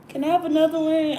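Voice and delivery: high, put-on little kid voice; pleading son. Son asks mom for another bomber, high child imitation. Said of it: "So he".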